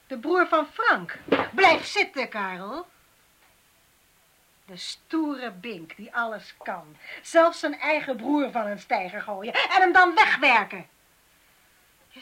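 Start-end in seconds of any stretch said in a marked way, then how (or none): none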